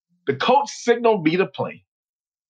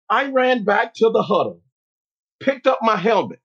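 ordinary delivery

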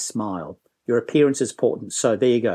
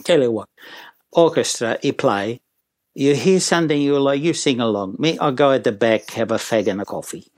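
mock Italian accent, offhand. Tenor leaves orchestra to play, lazy Italian accent. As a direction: hand over the show in Italian-accented English. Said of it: "did the whole routine again and says".

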